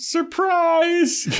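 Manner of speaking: squeaky high-pitched surprise; high mock surprise. Cordelia retold yelling surprise in a high voice. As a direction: shriek it up high like a fake cheer.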